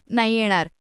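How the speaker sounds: ordinary delivery, nothing marked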